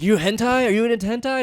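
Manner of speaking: lightly imitating a Japanese accent. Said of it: "in a very Japanese accent".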